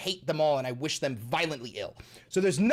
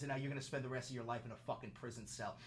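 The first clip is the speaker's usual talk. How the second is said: ordinary delivery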